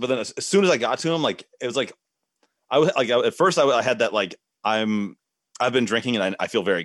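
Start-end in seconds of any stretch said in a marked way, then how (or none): none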